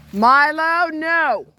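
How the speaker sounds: loud, high call to the dog; sharp command yelled at a runaway dog; shouting at dog. Real, not acted. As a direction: yell the dog's name to stop him. Calling dog off the road.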